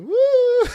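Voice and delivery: very high, loud hollered woo; comic imitation of a high-pitched holler; Janos. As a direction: holler a piercing, hammed-up woo at a baby. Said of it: "the way he kinda hollers at the baby".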